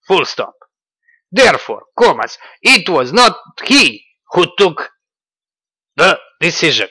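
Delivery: loud, high, theatrical reading; hammed-up Dugin dictation, punctuation included; mocking Dugin quote. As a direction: proclaim it like a pompous philosopher. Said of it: "Let's see".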